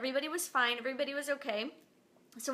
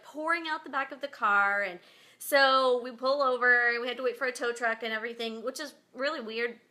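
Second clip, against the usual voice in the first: ordinary talk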